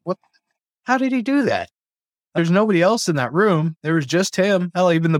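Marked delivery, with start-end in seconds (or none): 0.0-1.7 high amazed disbelief; can't believe the bird did it; astonished. Retold or imitated. Amazed at parrot's trick.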